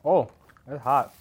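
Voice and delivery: slow, pained words through a burning mouth; mouth burning from spicy food. Real, not acted. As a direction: gasp it out with a hot gummy in the mouth. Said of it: "It's already burning my tongue".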